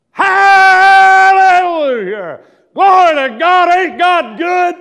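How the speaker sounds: sudden huge praise shout, high and drawn out; hollered hallelujah. Pretend. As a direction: burst into revival hollering. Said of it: "When you start hollering hallelujah".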